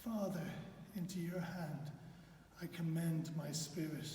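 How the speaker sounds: soft, fading last words; final whisper. Acted. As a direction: let it fade like a dying breath. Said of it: "the final whisper".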